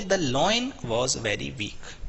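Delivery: drawn-out, careful example sentence; very slow reading. Shown as a demonstration. Teacher reads the example slowly to the class. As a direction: stretch every word out slowly. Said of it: "फिर बोलेंगे".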